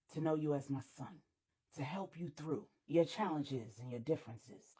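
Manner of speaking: a heavy-hearted father passing sentence, low and soft; lightly voiced grieving father, quiet and resigned; somber father. Manny before killing his son.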